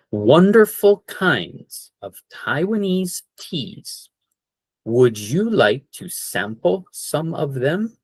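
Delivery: slowed down, each word spaced. Shown as a demonstration. Teacher to class, slowly and clearly. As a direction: spell it out slowly for learners. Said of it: "I'll go a little slower".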